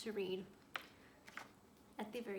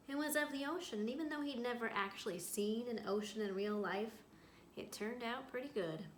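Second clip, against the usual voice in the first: reading a story aloud to a class; storyteller voice for young listeners; picture book read-aloud; 5 dB louder, slower than usual. A narrator's reading. Teacher reads picture book aloud.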